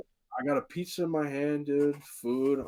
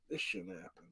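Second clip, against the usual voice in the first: disbelieving mutter; 6 semitones higher, 12 dB quieter than usual. Retold or imitated. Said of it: "dude, I'm like".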